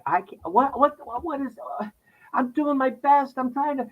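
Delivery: on a street corner at night, homesick, in a high tearful plea; tearful, high pleading. Retold or imitated.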